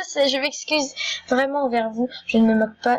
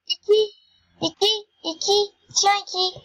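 high-pitched calling voice; appel haut perché; 8 semitones higher than usual. Real, not acted. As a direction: call out the name high, in short repeated bursts. Appelle Iki, voix haut perchée. (appel d'une voix aiguë)

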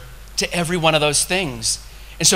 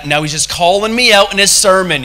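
louder, imitated grumble of a listener; mock offended; 5 dB louder than usual. Imitating a churchgoer stung by the sermon, mock indignant. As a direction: act out being singled out.

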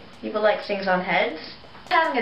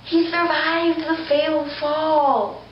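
slow, higher announcer voice; slow dramatic; 6 semitones higher, much slower than usual. Acted. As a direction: draw it out dramatically. Dramatic toy commentary.

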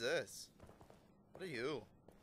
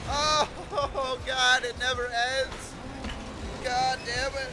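exasperated yelling; 6 semitones higher, 8 dB louder, much slower than usual. Real, not acted. Endless chase.

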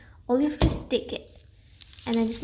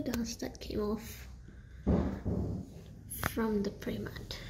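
whispered aside; 7 dB quieter, slower than usual. Real, not acted. Whispering about the dust.

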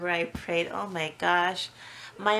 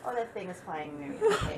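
ordinary talk

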